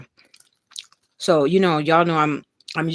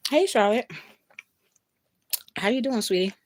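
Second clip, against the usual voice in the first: warm greeting; 7 semitones higher than usual. Real, not acted. Greeting a child.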